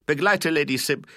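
high, loud command breaking off; loud order. A narrator's reading. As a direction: call out an order, then stop short. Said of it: "rief er, um seine Verlegenheit zu verbergen". (lauter, abgebrochener Befehl)

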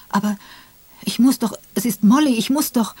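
distraught husband pleading to touch his wife. Acted. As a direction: beg to be allowed to reach her. Tim pleads over his dead wife.

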